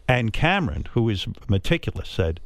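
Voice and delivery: ordinary talk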